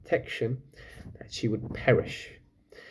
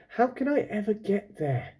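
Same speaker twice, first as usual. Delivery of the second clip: a girl's anxious wondering, voiced higher; wondering aloud; 9 semitones higher than usual. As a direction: ask it as a worried young girl. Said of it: "she wondered".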